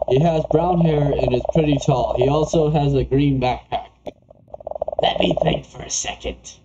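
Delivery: normal talking voice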